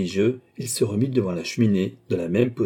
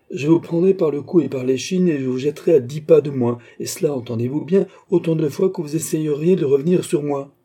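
raised, forceful warning; emphatic threat; 5 semitones higher, 4 dB louder than usual. A narrator's reading. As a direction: threaten him with a louder, higher voice. Threatens his opponent in quarrel. (menace appuyée, voix plus forte et haute)

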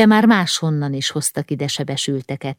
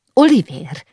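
louder, higher call of a name; crying out; 5 semitones higher, 5 dB louder than usual. A narrator's reading. Wife calling out to her husband on finding him, crying out. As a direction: call out the name, falling at the end. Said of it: "kiáltotta a felesége".

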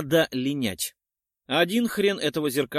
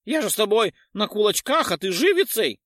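high, louder indignant squeal; squealing ghoul; 6 semitones higher, 5 dB louder than usual. A narrator's reading. Ghoul squeals at the witcher, outraged and high. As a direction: shriek about foul play. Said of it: "завизжал поганец".

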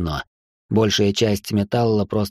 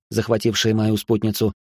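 plain reading voice

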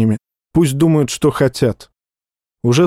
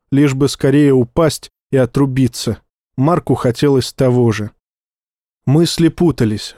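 ordinary delivery, nothing marked

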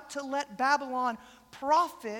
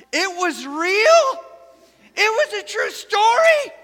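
high, loud stunned shriek; girl screaming out; 9 semitones higher, 13 dB louder, slower than usual. Retold or imitated. Teen girl in the theater screaming her disbelief, high and loud. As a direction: scream it in shock, like a girl at the movies. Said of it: "one of the girls in my group screams out".

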